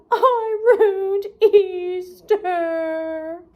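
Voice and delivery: very slow, blubbering cartoon sob; crying. Patrick crying after scaring the bunny away, slow sob. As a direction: wail it out, heartbroken. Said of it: "And Patrick began to cry".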